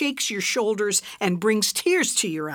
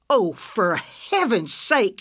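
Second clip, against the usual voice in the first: dismayed cry; 5 dB louder, slower than usual. Acted. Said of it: "she cried".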